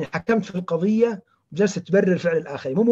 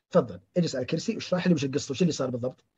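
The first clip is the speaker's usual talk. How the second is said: softer, lower, quickly reassuring; calming invitation; 4 semitones lower, 5 dB quieter, faster than usual. Shown as a demonstration. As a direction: gently invite an upset patient to talk. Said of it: "لكن بطريقة ثانية". (دعوة هادئة بصوت منخفض)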